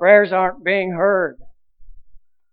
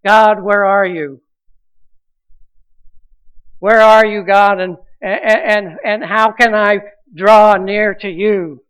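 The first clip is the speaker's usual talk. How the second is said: a louder, pleading cry to God; 5 dB louder than usual. Retold or imitated. Thirsty soul crying out to God, louder pleading. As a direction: call out to God, searching. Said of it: "a voice within us that gets thirsty and cries out".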